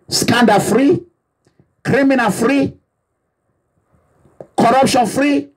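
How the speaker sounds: ordinary talk, nothing marked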